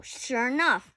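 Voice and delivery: usual speaking voice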